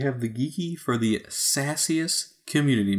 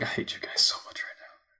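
whisper; 6 dB quieter than usual. Retold or imitated. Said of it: "So I'm like".

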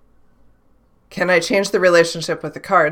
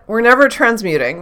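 usual speaking voice

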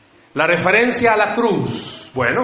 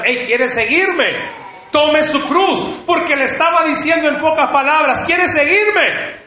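preacher voicing Jesus; 9 semitones higher than usual. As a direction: call it out high and emphatic, word by word. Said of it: "que Jesús viniera y le dijera".

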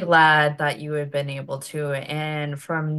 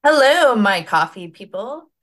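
excited greeting; 4 semitones higher, 8 dB louder than usual. Real, not acted. Host's cheerful show greeting.

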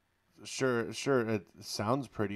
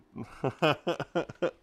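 amused laugh; 6 semitones higher, 5 dB louder than usual. Real, not acted. Laughs after bit.

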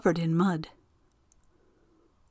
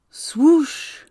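wind whoosh; 7 semitones higher, 4 dB louder than usual. A narrator's reading. Narrator voices the wind.

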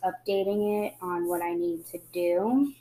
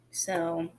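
ordinary talk

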